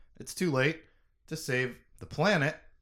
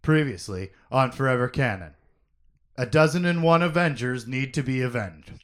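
announcer voice; 2 semitones lower, 4 dB louder than usual. Acted. TV style recap intro.

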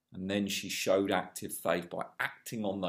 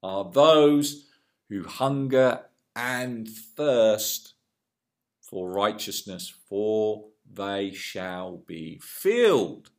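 preached beatitude; 6 dB louder, much slower than usual. Retold or imitated. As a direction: proclaim it slowly with force. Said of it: "he said this".

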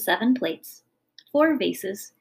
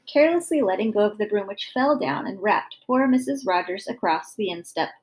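plain reading voice

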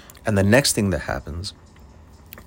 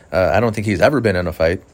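usual speaking voice

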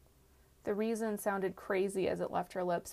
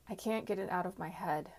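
ordinary delivery, nothing marked

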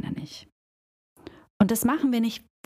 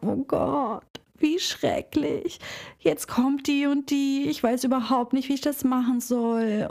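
higher, slow self-pitying lament; victim role; 5 semitones higher than usual. Shown as a demonstration. Hairdresser complaining to a colleague about a client, higher and self-pitying. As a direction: play the helpless victim, voice lifted and dragging. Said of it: "Hörst du den Unterschied?".

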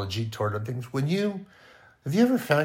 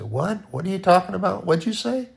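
rising, higher puzzled asking; puzzled listener; 7 semitones higher than usual. Retold or imitated. Preacher playing people straining to hear. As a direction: lean in and ask, confused. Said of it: "They're going to say".

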